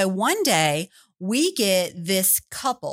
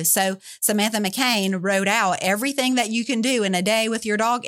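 ordinary talk

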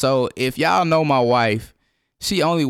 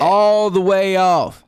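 slow emphatic annoyance; 5 semitones higher, 5 dB louder, slower than usual. Real, not acted. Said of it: "I can see that".

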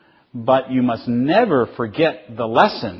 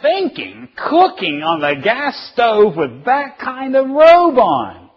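high, loud, outraged scolding; acted frantic telling-off, words stretched out; screaming parent; 12 semitones higher, 6 dB louder, slower than usual. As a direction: scream at a child after a scare. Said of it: "you're screaming at her".

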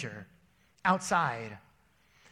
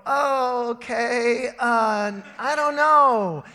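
higher, louder, slowed and stammering; flustered dad; 4 semitones higher, 7 dB louder, much slower than usual. Retold or imitated. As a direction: caught out and stalling for a lie. Dad caught off guard, flustered.

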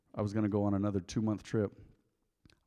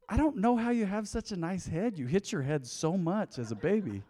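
high-pitched imitation of a mom; mom's voice; 7 semitones higher than usual. Mom marvels at bald son's head, high mom voice. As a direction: lift it high, like an amazed mother.

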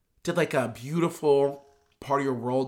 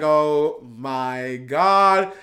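stunned oh my God; 4 dB louder, much slower than usual. Retold or imitated. Reacting to the moment.

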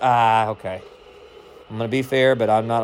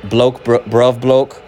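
mock British; much slower than usual. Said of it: "what's your first name".

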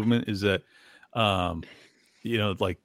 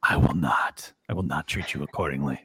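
mock-solemn refusal; 10 semitones lower than usual. His mock refusal of that demand.

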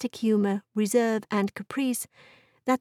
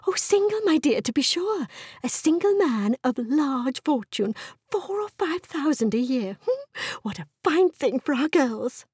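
high, gushing matchmaking mother; delighted scheming wife; 6 semitones higher than usual. Acted. Wife to husband about a rich single man, delighted. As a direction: gush with relish over the rich bachelor.

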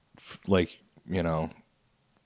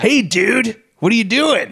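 much higher, louder breathy dude voice; lazy, breathy bro greeting, pitched way up; T J Miller voice; 13 semitones higher, 5 dB louder, slower than usual. Retold or imitated. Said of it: "he has this, like, really annoying, breathy voice".

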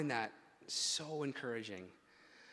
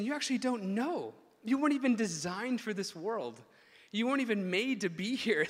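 high, loud, insistent; emphatic correction; 7 semitones higher, 5 dB louder than usual. Retold or imitated. Preacher voicing Jesus telling us we don't know, insistent correction. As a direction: press each point home with rising force. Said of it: "And Jesus is saying".

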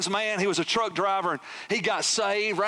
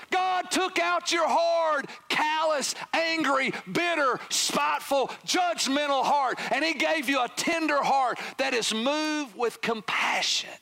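high, ringing sermon delivery; impassioned preaching; 7 semitones higher, slower than usual. Real, not acted. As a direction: drive each phrase home, stretching the key words. Preaches at raised pitch.

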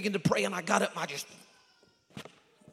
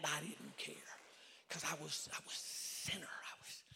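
very soft, whispery testimony; hushed confession; 5 semitones lower, 13 dB quieter than usual. Retold or imitated. Preacher confessing he was a sinner, hushed whispered confession. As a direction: let it sink almost to a whisper. Relives his conviction as sinner.

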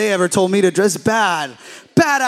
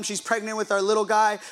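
ordinary talk

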